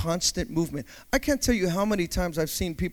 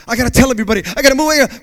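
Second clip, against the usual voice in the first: mock restless urge; 5 semitones higher, 7 dB louder, much faster than usual. Mocking restless victim.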